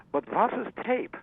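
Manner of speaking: playing a baffled German boss who has never heard of tape; thick German accent. Alfred Lyon asks Gil Mallet about tape, hammed-up German accent. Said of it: "in his thick German accent".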